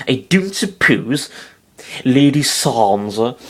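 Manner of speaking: slow English accent imitation; Peter Dinklage English accent. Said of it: "Peter Dinklage doing his fucking English accent".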